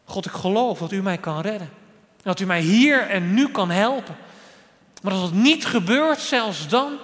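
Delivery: bold prayer. Acted. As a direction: build a daring prayer with rising force. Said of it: "Dan durf je ook zo te bidden".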